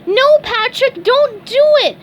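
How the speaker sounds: high, loud squeaky plea; SpongeBob crying out. A narrator's reading. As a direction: beg him to stop, high and loud. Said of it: "SpongeBob cried".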